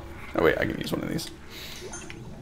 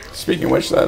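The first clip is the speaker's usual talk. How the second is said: ordinary delivery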